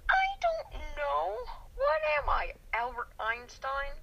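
high, drawn-out incredulous whine; slow, loud, high-pitched exasperation; exasperated protest. Real, not acted. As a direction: protest as if asked the impossible. Exasperated player protests being asked.

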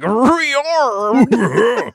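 high, exaggerated cry of the word; mock exclaim. Mocking a co-host's puzzled question, high exaggerated exclaim. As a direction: fling the word up like a mocking cheer. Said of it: "the way you're all like".

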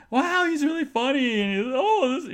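high, loud, gushing; imitated delight. Salesman reacting to the jokes, high hammed-up delight. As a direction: play an impressed salesman gushing.

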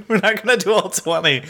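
amused, laughing clarification; laughing reassurance. Real, not acted. Laughing repeat after the wow.

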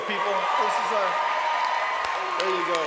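loud, high, fired-up preacher calling to the crowd; preaching at full force. Acted. Pastor rousing the Easter congregation to shout, booming. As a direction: whip the church into cheering.

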